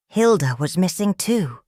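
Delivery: plain reading voice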